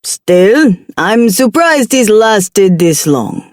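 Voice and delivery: slow, cheerful Jamaican drawl; a hint of jolly Jamaican demon; jovial Jamaican accent. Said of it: "His tone was much too jovial".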